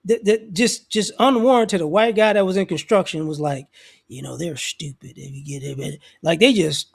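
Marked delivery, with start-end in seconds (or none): 4.1-6.0 lower, much softer, confiding sneer; sneering low aside. Retold or imitated. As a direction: mutter it like a bigot sharing a secret.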